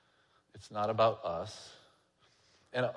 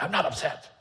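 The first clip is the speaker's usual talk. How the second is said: defensive denial; 5 semitones higher than usual. Retold or imitated. Defensive retort in story.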